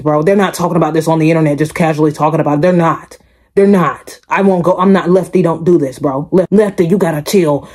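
ordinary talk, nothing marked

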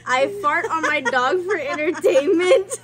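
laughing through the words; reading it aloud while cracking up; gleefully reading gossip. Real, not acted. Reading a funny anonymous message.

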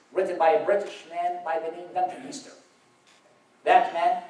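ordinary talk

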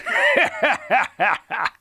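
loud high booming laugh; giant's mocking laugh. A narrator's reading. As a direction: laugh in triumph. Giant laughs after taunt.

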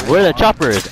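normal talking voice

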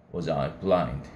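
ordinary delivery

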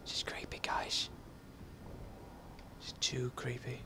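hushed, spooked whisper; creeped-out whisper. Real, not acted. Gamer whispering to friends in a creepy level. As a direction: whisper it like the dark scares you.

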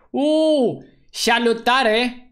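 high "ooh" into a drawn-out hello; excited greeting. Real, not acted. Streamer greeting viewers at stream start, excited.

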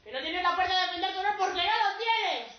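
high, loud taunting yell; jeering shout. Acted. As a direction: holler insults at a passer-by. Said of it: "me gritaban".

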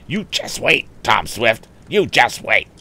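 worked-up, high-pitched sputter; sputtering threat. Acted. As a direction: sputter it out indignantly.

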